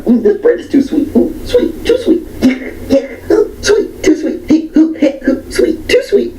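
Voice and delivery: very high squealing ooh sweet, laughing through it; shrieking picky woman. Retold or imitated. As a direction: squeal like a twitching fussy diner. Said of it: "the manager comes up to her".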